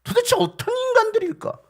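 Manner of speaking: high, loud question of disbelief; exasperated exclamation. Real, not acted. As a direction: cry out in bafflement. Preacher exclaims at unbelief. (어이없어 높고 크게 외치듯)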